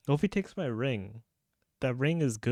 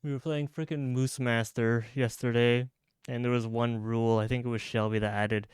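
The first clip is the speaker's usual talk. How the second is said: ordinary talk, nothing marked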